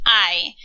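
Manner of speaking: "I" starting straight on the vowel, no H; silent H. Shown as a demonstration. Teacher repeats the Spanish verb for learners, "I" with silent H. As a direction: pronounce "I" like the English word eye. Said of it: "talking about the silent H".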